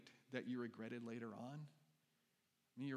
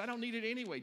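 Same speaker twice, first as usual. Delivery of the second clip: put-on higher and louder, cocky kid; bold refusal; 9 semitones higher, 6 dB louder, faster than usual. Pastor acting David turning down Saul's armor, bold. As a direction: brush the armor off with confidence.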